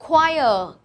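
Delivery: choir said as "kwire", with a w sound; careful model of choir, "kwai-uh"; say choir right. Shown as a demonstration. Teacher modelling the word for her class, clear pronunciation. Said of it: "cái này mình đọc là".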